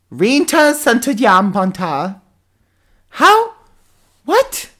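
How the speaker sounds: outraged. Real, not acted. Mangles name, then exaggerated outrage.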